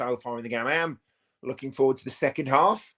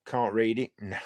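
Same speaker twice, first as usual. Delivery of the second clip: normal talking voice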